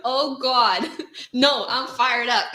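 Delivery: loud laughing through words. Real, not acted. Laughing at incoming comments.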